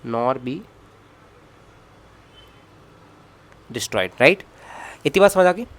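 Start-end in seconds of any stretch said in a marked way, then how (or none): 0.1-4.2 slow, spaced dictation pace; teacher dictating a definition; dictating slowly. Shown as a demonstration. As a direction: read it out word by word for notes. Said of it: "slow dictation of the law".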